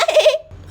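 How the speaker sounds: high, mocking giggle; teasing laugh. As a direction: laugh at the witch. Laugh at trapped witch. (चुड़ैल पर हँसी)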